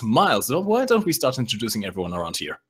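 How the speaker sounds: ordinary talk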